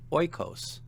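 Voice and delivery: Oikos stressed on OI, pitched high then falling; stress on first syllable. Shown as a demonstration. Teacher showing a circumflex example, Oikos stressed on OI. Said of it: "a circumflex on the penult".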